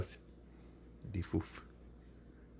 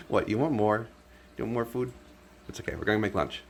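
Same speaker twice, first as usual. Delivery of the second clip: usual speaking voice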